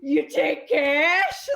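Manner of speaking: high, loud, very drawn-out question; hammed-up excitement. Pa so excited to give the salesman cash, hammed-up delight. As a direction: imitate an overjoyed buyer, stretching the last word. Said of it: "he was so excited to give him cash".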